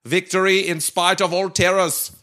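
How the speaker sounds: orate grandly in a mock German accent. Reading Churchill's war speech on request, in a German accent. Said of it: "with a German accent, as requested".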